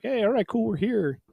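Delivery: lightly mocking, breezy and high; mock casual arrival. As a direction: act out an easygoing team showing up. Acting out the team's past attitude.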